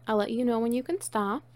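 ordinary talk

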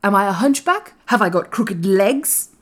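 put-on ringing, indignant young master; commanding. A narrator's reading. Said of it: "he commanded".

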